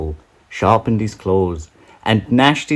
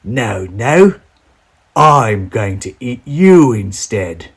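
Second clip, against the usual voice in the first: louder, slow menacing troll; growling troll; 3 semitones higher, 5 dB louder, slower than usual. Acted. Troll refusing the little goat, slow and loud. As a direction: threaten to eat him. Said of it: "the troll growled".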